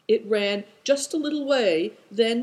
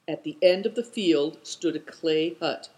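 ordinary delivery

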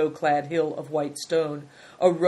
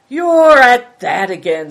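loud, slow scoffing jeer; 6 semitones higher, 14 dB louder, slower than usual. A narrator's reading. A merchant scoffing at the excuses of Greencoat, loud and slow. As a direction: sneer it out, drawn out and loud. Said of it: "scoffed Good Fortune".

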